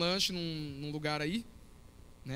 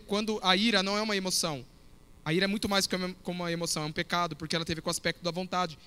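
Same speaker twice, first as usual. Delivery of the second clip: ordinary delivery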